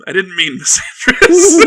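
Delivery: helpless laugh through a slow correction; laughing self-correction. Real, not acted. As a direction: crack up while owning up to a slip. Corrects own slip amid shared laughter.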